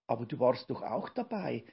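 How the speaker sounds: ordinary delivery, nothing marked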